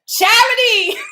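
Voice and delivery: name called out high and loud; excited shout. Real, not acted. Host revealing Charity as the next winner, shouting her name with joy.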